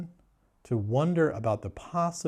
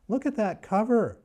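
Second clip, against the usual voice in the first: high incredulous squeak, hammed-up outrage; mock disbelief; 8 semitones higher, slower than usual. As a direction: act scandalised at the cover. Mock outrage at book cover.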